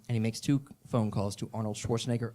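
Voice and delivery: ordinary delivery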